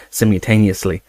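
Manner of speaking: plain reading voice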